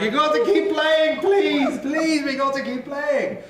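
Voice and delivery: high, loud pleading; desperate begging. Acted. Injured Edmund pleads with the players, high desperate begging. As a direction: beg them not to stop. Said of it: "he's just like".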